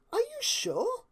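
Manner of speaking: high, prim question; Rarity's doubt. Acted. Rarity asking Pinkie, prim doubtful voice. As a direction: ask it a little anxiously. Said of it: "Rarity asked".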